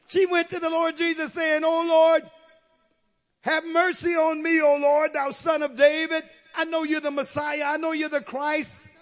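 high, loud sustained cry; full-force preaching. Retold or imitated. Preacher voicing mother's plea to Jesus, crying out.

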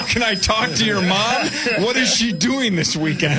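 put-on a kid asking for the mom; kid on the phone. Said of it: "He was calling his classmate and go".

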